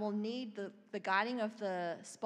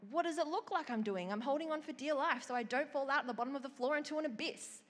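exasperated, higher and a bit louder; irritated retort, pitched up; bad attitude; 6 semitones higher, 3 dB louder than usual. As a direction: snap back, fed up and defensive. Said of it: "still had a bad attitude".

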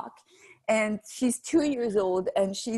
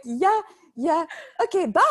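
nervous brush-off; 8 semitones higher, 5 dB louder than usual. Retold or imitated. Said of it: "I'm like".

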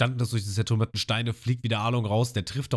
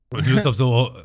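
ordinary delivery

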